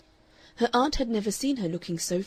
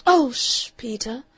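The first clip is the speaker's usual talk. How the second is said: cry; 5 semitones higher, 7 dB louder than usual. A narrator's reading. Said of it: "cried Miss Stanley".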